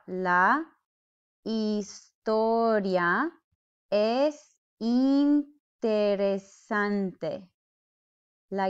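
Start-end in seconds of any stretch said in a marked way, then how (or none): 0.1-7.5 historia es interesante drawn out, very slow; sounded out slowly. Shown as a demonstration. Teacher sounding out the sentence slowly for learners. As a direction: stretch La historia es interesante syllable by syllable. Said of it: "This is how it is sounded out".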